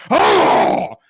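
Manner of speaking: loud, high imitated lunge; sudden burst of mock dog noise; dog attack noise. Possessed bulldog attacking the girl, imitated attack cry. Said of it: "all of a sudden the dog goes".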